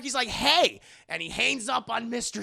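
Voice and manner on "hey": loud, high "Hey!"; sudden raised-voice interjection; sharp call. Acted. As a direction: call out to stop someone hanging up. Tony cuts Peter off.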